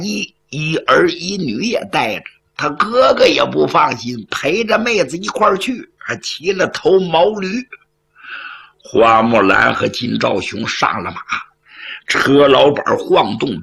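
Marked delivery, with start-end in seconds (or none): none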